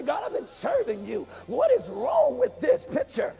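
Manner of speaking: imitating an indignant believer, last line jumps up high; mock angry complaint. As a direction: huff at God in a mock grievance. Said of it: "you get all angry and say".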